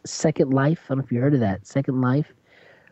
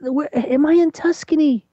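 put-on very high, amazed old-lady voice; high granny voice, wondering where she is; old grandmother voice; 11 semitones higher than usual. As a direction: squeak like a delighted granny. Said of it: "she's like".

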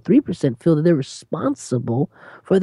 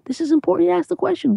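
ordinary talk, nothing marked

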